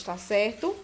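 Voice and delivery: ordinary talk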